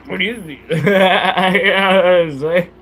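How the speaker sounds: slow, laughing through the words. Real, not acted. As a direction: ask it while still laughing.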